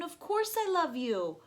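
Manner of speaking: cried out. A narrator's reading. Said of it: "cried Missus Raccoon".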